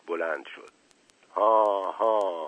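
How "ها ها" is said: slow, drawn-out acted laugh; menacing satisfied laugh. A narrator's reading. Officer satisfied by the confession, slow menacing laugh. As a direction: gloat with a spoken ha-ha. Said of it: "صدای خندهٔ رضایت نایب که بسیار هولناک بود".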